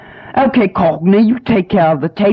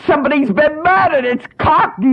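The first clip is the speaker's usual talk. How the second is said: shocked discovery; 10 semitones higher than usual. Acted. Finds the assistant murdered.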